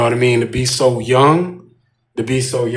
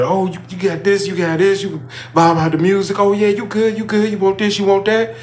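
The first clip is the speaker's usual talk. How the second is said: high-pitched imitation of a cheering manager; mock hype man; 8 semitones higher than usual. Managers pumping up a new worker, high mock cheer. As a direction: hype him up like an eager boss, pitched well up.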